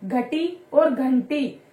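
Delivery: "घटी" plain, then "घंटी" with a clear nasal n, slowly; slow contrast. Shown as a demonstration. Teacher contrasting two words for pupils, slow and clear. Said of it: "दोनों का बोलने का भी वे अलग हुआ".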